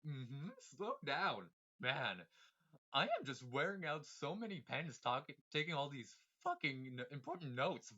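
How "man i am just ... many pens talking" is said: ordinary delivery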